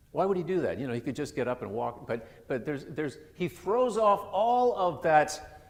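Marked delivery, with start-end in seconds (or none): none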